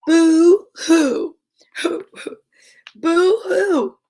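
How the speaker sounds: high teasing boo hoo; mocking bat. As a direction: taunt him like a bratty bat. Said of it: "the bat mocked".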